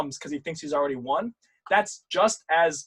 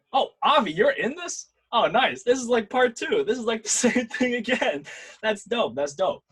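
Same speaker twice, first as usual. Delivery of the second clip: excited, higher-pitched greeting; light up with amused delight, a laugh breaking in; delighted surprise; 8 semitones higher than usual. Real, not acted. Coach spots a returning student in the class, delighted.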